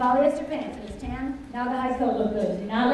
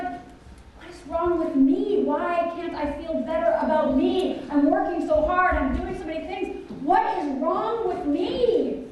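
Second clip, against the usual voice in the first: slow, drawn-out, higher-pitched plea; acted-out late-night despair at oneself; anguished self-questioning; 5 semitones higher, slower than usual. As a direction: wail it slowly, stretching each phrase. Acting out night-time self-questioning.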